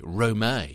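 Rome as "ro-MAY", ending in an AY sound; accented pronunciation. Shown as a demonstration. Coach repeating the romantic character name, Rome pronounced "ro-MAY". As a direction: say Rome in two syllables, "roh-MAY". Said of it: "Sounds romantic".

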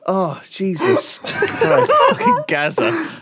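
slow laughing groan, very high. Real, not acted. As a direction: break into laughter mid-impression. Laughing in the put-on voice.

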